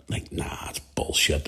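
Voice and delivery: hushed, dismissive under the breath; breathy whispered denial; whispered disbelief. Retold or imitated. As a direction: whisper it like you refuse to believe it. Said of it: "and you go".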